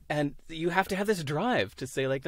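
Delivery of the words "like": normal talking voice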